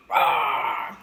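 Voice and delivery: loud breathy mock roar. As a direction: let out a loud animal roar. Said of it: "until I go".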